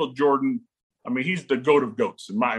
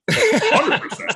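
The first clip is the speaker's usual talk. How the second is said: much higher and louder, dead certain; emphatic; 6 dB louder than usual. Retold or imitated. Dad refusing Ohio State recruiters, loud emphatic certainty. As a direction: nail it down with total conviction.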